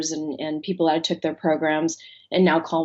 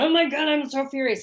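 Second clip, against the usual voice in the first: mock furious; 8 semitones higher than usual. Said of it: "I got so angry reading it".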